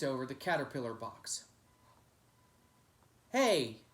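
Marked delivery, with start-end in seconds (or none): 3.3-3.8 sharp loud call, higher; shout. Acted. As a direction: shout to stop the kick. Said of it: "Toe Stomper shouted".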